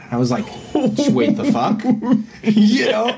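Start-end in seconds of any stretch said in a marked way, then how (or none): 0.9-1.8 incredulous laugh. Retold or imitated. Retelling dad pulling tooth. 1.9-2.4 a sudden high burst of laughter. Real, not acted. Laughing at own story. 2.4-3.1 talking through a laugh, pitched up. Real, not acted. Telling the host the tooth story, still laughing.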